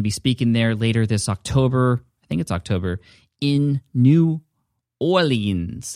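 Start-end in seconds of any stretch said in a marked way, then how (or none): 3.4-5.9 attempted New Orleans accent, drawn out; badly faked Southern-style New Orleans accent. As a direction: put on a hammy, unsure New Orleans accent. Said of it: "the worst accent I've ever done".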